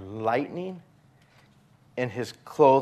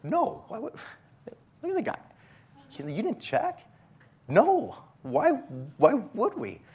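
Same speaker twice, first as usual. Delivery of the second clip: incredulous reply; 13 semitones higher than usual. Retold or imitated. Cops answering Dan, incredulous.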